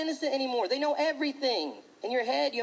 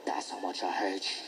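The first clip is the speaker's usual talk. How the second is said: menacing bully; 13 semitones lower, 5 dB quieter than usual. Retold or imitated. Bully on the bus to another kid, low and menacing. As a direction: growl it low like a creepy little psycho.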